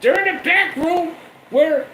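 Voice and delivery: very high panicked caving Heyman. Retold or imitated. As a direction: give it up in a high frantic squeal.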